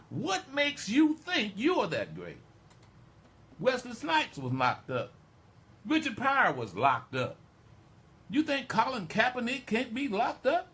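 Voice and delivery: loud, high-pitched indignant challenge; impassioned exhortation. Real, not acted. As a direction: build to a full-force preacher's rebuke. Heated exhortation building to challenges.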